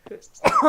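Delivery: sudden sharp sneeze; a real loud sneeze; sneezing. Real, not acted. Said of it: "Excusez, j'éternue".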